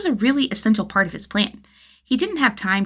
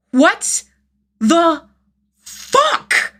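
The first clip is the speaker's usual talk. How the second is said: very high, loud incredulous yell; shrieked disbelief; 10 semitones higher, 6 dB louder, much slower than usual. Acted. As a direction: screech it out in shock. Jason explodes in disbelief.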